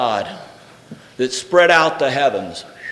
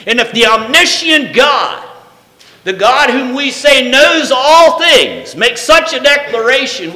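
shouted, higher preaching with every line driven hard; preaching at full force; 11 semitones higher, 8 dB louder than usual. Acted. Pastor drives the point home to the church, shouting. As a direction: preach it out to the back row.

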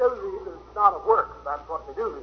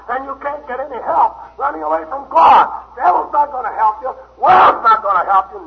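loud, high preaching at full force; a revival preacher calling sinners home; fiery preaching; 6 semitones higher, 7 dB louder than usual. Acted. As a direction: thunder it out to the congregation.

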